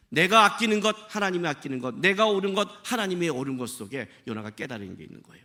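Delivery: normal talking voice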